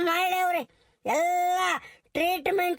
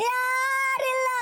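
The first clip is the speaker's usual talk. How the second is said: breaking sob; 4 semitones higher, much slower than usual. Acted. Wife sobs, alone without husband.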